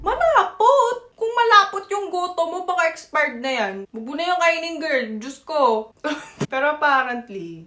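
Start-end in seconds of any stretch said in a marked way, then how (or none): 0.0-5.9 mock scandalized retort. Telling friend her goto expired, sassy scoff.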